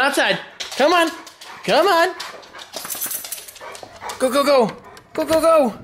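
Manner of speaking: high, sing-song calling the dogs; high pet-talk voice. Real, not acted. Owner coaxing the dogs out to the yard, high sing-song calls.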